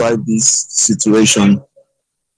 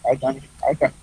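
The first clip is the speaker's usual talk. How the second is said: faint voice on a weak phone line; quiet, distant caller; barely audible; 10 dB quieter, much faster than usual. Real, not acted. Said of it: "your voice is very faint".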